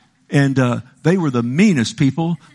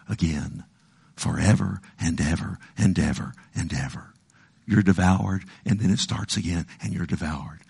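fading hush; 5 semitones lower, 5 dB quieter than usual. Acted. Dwelling on endless torment.

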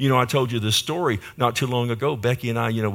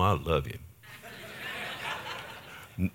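chuckling through it; laughing; 6 semitones lower, 12 dB quieter, much slower than usual. Real, not acted. Preacher laughing to Oklahoma folks in the pews, chuckling. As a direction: laugh it out fondly to the crowd.

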